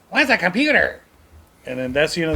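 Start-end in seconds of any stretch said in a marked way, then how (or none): 0.1-1.0 higher-pitched mock kid voice; kid in commercial. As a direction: imitate the clueless kid from the ad. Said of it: "And the kid goes".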